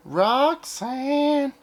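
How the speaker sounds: high drawn-out Roxanne; sing-song title. Acted. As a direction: call the name out high and long. Exclaiming the film title.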